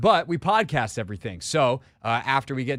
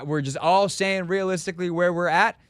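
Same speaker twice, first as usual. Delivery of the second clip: ordinary talk, nothing marked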